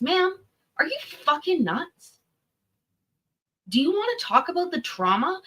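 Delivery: high-pitched disbelief at her nerve; incredulous outburst. Real, not acted. Reacting to the ex-wife's testimony, high incredulous outburst. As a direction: climb high, as if you can't believe your ears.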